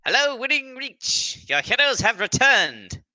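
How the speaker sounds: high, drawn-out heroic announcement; calling out. Pretend. Hero announcing the return to the town, slow high call. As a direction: hail the town slowly and grandly. Player hails the distant town.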